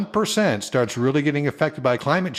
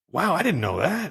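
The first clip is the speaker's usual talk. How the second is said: higher-pitched retold amazement; amazed; 6 semitones higher than usual. Host recalling his reaction to the podcast, amazed. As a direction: re-enact finding out something new.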